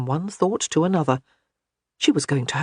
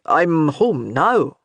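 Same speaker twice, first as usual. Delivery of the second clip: very slow, louder pointed reminder; reminding; 4 dB louder, much slower than usual. A narrator's reading. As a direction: spell out "I'm home now" slowly. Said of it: "he reminded her".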